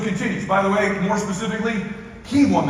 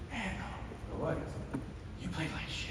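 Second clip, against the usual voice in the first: put-on weak old dad, proud but fading; frail father; 15 dB quieter than usual. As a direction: give the sick father's faint pride. Father teasing son on phone.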